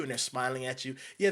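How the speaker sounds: ordinary talk, nothing marked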